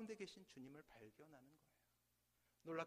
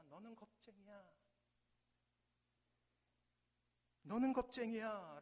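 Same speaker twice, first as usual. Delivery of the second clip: put-on child's angry yell, louder on the second; angry shout; 4 semitones higher, 7 dB louder than usual. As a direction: hurl the insult in anger. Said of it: "소녀는 화가 납니다". (화난 아이의 외침)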